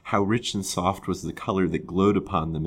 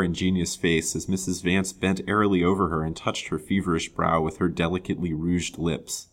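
plain reading voice

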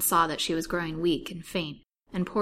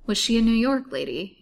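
higher, louder eager question; pointed inquiry, voice lifted; deeply interested; 5 semitones higher, 8 dB louder than usual. A narrator's reading. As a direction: ask with keen interest. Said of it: "evincing a deep interest".

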